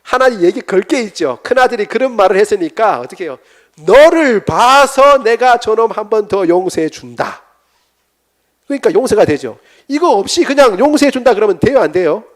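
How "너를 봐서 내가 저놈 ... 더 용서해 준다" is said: 너를 said loud and slow, high; a father granting pardon with weight; loud, slow pronouncement. Acted. God tells the son he forgives, loud and slow.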